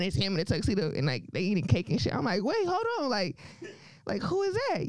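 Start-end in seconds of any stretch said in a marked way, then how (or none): 2.4-4.9 mock shock. Shocked at the wedding photo.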